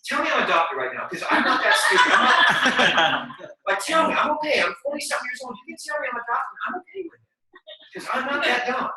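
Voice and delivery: loud, high, laughing protest; laughing disbelief. Retold or imitated. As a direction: laugh through the words. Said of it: "I'm like".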